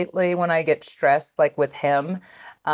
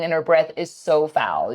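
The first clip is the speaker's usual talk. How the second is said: usual speaking voice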